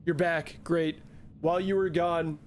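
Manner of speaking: usual speaking voice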